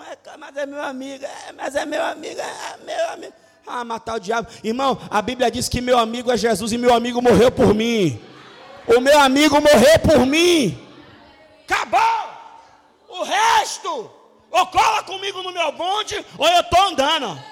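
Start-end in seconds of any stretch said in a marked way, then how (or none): 0.0-3.3 mock sobbing, pleading for a friend; mock crying plea. Preacher mimics a crying friend's plea, mock sobbing. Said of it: "friend's whiny excuse, crying". 4.6-17.4 loud, fervent shouted preaching; full force preaching. Real, not acted. Pastor preaches to congregation at full shouting force.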